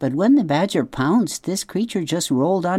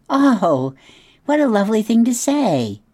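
high, louder gush of flattered delight; delighted opossum; 8 semitones higher, 4 dB louder than usual. Acted. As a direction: gush with pleasure at the compliment.

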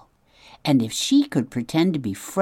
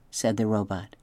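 ordinary delivery

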